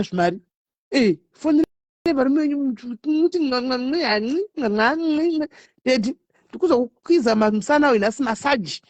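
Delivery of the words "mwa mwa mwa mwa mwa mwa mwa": kiss sounds. Shown as a demonstration.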